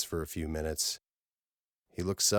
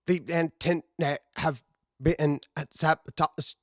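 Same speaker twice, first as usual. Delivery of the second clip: high, slow, strained voice breaking up over a bad radio link; broken distress call; 11 semitones higher, 4 dB louder, slower than usual. Woman on a garbled recording begging for rescue, strained and broken. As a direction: gasp the words out in pieces like a failing transmission.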